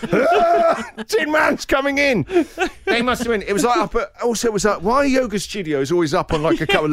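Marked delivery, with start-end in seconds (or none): 0.1-1.0 loud, high strained grunt; effortful grunt. Pretend. Hauling himself out of the car, loud strained grunt. 1.1-2.2 mock announcement. Joking to the hosts about himself, high laughing call.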